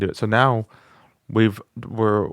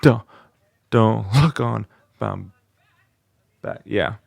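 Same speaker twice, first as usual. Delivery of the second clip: powered attempt; slower than usual. Shown as a demonstration. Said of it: "engage those correct muscles".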